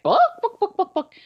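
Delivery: high squawky bawk bawk of a chicken; mock chicken clucking, book book. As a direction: cluck it out like a hen. Chicken in joke says book.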